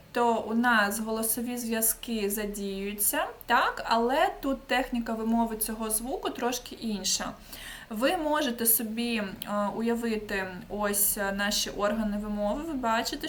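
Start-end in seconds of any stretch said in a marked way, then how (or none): none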